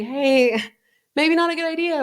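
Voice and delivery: high, coaxing imitation of a concerned onlooker; politely steer someone off the junk food; high-pitched tactful suggestion; gentle intervention. Imagined person stepping in to stop her.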